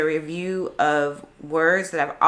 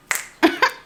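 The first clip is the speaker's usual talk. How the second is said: high laugh; 4 dB louder than usual. Real, not acted. After counting to ten.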